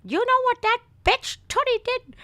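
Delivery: high, mock scandalized grandma; imitating step-grandmother. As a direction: tattle like a cursing grandma. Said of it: "'cause she'd get to cursing".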